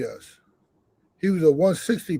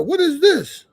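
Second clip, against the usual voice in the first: commissioner's outrage; 9 semitones higher, 3 dB louder than usual. Retold or imitated. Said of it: "the commissioner was like".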